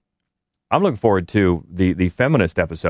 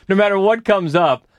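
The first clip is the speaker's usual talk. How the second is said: ordinary talk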